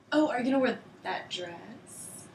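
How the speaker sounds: excited roommate asking about "dress"; interested emphasis on dress. Shown as a demonstration. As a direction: lean into "dress", curious and approving. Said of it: "volume and intonation are used to put emphasis on the word dress".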